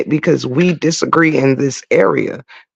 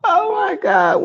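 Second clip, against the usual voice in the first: laughing cry; slower than usual. Real, not acted. Still laughing after waking.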